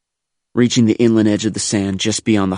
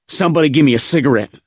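quick, raised, louder yell; yelled demand; 7 semitones higher, 5 dB louder, faster than usual. A narrator's reading. Rattled Marine to squad mates nearby, yelling. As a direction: yell out fast for a smoke. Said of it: "I yelled to my squad mates".

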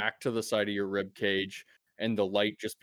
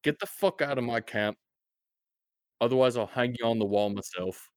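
threat; 3 semitones higher, 4 dB louder than usual. Acted. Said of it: "He says".

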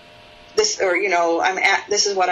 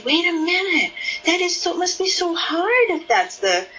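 curious empathic tone; 9 semitones higher than usual. Shown as a demonstration. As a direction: gently marvel at how hard it must be. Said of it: "enthusiasm in my voice".

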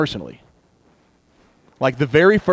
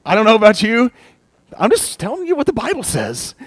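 higher, louder cheeky aside; playful teasing; 8 semitones higher, 5 dB louder than usual. Real, not acted. Preacher joking with the congregation, higher and cheeky. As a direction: tease the congregation.

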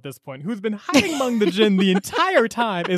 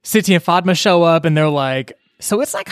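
ordinary delivery

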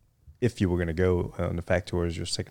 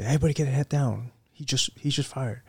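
higher-pitched mock warning under fire; retold battlefield warning, pitched up; imitated combat call; 7 semitones higher than usual. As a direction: act out a soldier's urgent call without shouting. Acts out soldier under fire.

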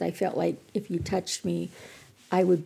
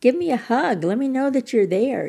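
"Give me a hug" said higher and louder, coaxing; playful insisting; 7 semitones higher, 5 dB louder than usual. Retold or imitated. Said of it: "And I said".

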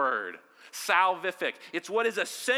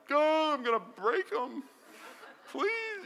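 higher, laughing mock panic; mock terrified; 8 semitones higher than usual. New father holding his baby, mock panic. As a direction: play the scared new father. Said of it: "you're terrified".

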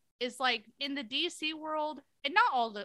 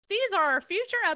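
announcing grandly; 7 semitones higher, faster than usual. Retold or imitated. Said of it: "when we tell our listeners".

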